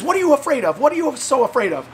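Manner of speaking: high, mock-baffled question; incredulous questioning. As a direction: imitate a friend puzzled by the fear. Retold friend questioning his fear.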